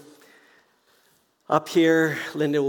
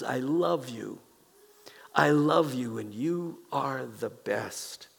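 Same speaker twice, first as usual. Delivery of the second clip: 1 tender hush; 5 dB quieter, slower than usual. Pretend. Voicing God's tender message.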